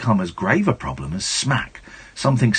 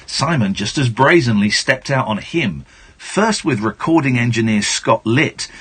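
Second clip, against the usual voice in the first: usual speaking voice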